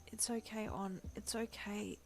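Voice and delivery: soft, strained wheeze; quiet pained wheezing. A narrator's reading. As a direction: speak winded after the kick. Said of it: "he wheezed".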